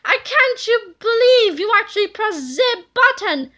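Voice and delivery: can't believe what he's hearing; shocked outburst; incredulous. A narrator's reading. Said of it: "screamed Super Mega Tippy incredulously".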